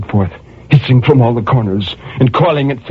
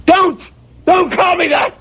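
shrill high protest; high furious plea against pity; frantic cry. Acted. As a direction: hurl it at him. Outburst at fat man.

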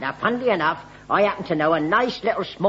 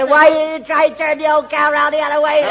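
hearty shouted punchline, higher and louder, breaking into laughter; country yokel roaring out his own joke; laughing shout; 7 semitones higher, 5 dB louder than usual. Retold or imitated. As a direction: bellow the joke's punchline while cracking up. Retold farmer joke punchline, laughing.

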